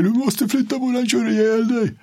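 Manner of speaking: very high, strained warning yell; retold screaming. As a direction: scream at him to get out of the way. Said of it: "de här grabbarna bara skriker". (gänget skriker en varning)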